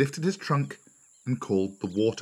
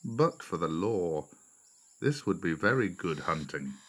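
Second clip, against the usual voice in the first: wonder; 4 semitones lower, 6 dB quieter than usual. A narrator's reading. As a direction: marvel quietly in a lowered voice. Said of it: "he said in wonder".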